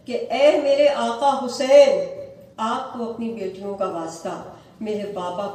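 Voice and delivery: weeping plea. Retold or imitated. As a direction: cry out like a daughter begging for her father. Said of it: "رو رو کر مجھے کہتی ہے". (روتی ہوئی فریاد، آہستہ اور بلند)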